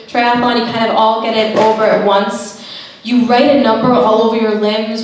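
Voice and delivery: ordinary delivery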